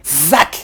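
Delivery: sudden "zac" sound effect; imitated snap effect. Shown as a demonstration. Teacher making the words appear, abrupt "zac" noise. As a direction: punch out a quick cartoon "zac".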